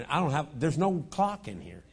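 ordinary delivery

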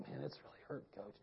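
tiny, hushed voice of a hurt player; very soft, feeble protest; pained near-whisper. Retold or imitated. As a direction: breathe it out weakly to the coach. Acts injured boy answering coach.

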